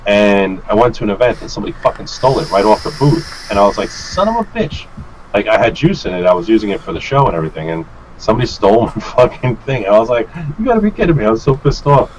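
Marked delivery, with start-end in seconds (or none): 3.9-4.9 high, drawn-out curse of frustration; high-pitched mock fury; retold exasperated swear. Retold angry swear at theft. 10.6-11.2 high-pitched exasperated outburst; high, put-upon protest; retold disbelief.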